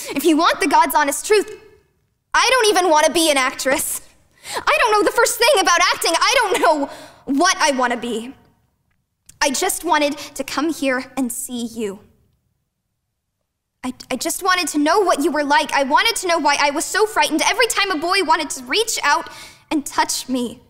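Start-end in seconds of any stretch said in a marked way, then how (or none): 0.1-8.3 loud, high burst of confession; distressed outburst. Acted. 13.8-20.6 raised, pitched-up, urgent pleading; impassioned confession. Acted.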